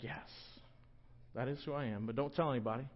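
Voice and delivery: half-whispered secret; secretive hush. Retold or imitated. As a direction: keep it secret, hushed. Peter voiced secretive.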